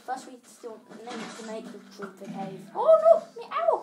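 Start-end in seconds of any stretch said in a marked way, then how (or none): 2.7-3.8 very high, loud shriek; shriek of surprise. Real, not acted. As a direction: yelp in sudden alarm. Sudden shriek in game.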